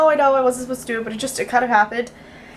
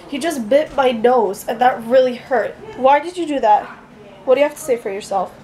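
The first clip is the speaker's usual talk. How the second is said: ordinary delivery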